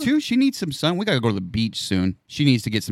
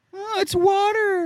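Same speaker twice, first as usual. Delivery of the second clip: high, slow whiny mocking voice; mock whine; slower than usual. Mocking a kid at the sprinkler, high slow whine. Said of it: "What a bitch".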